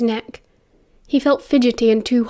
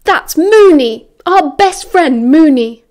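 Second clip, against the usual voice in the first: slow, raised, emphatic outcry; loud protest; 5 semitones higher, 8 dB louder, slower than usual. A narrator's reading. James protesting to Sirius about their best friend, loud and emphatic. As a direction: cry it out slowly, louder and higher.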